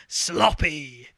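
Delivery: Cockney sloppy, loud; Cockney accent. Retold or imitated. Repeating the boss line on request, loud Cockney. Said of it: "in a Cockney accent".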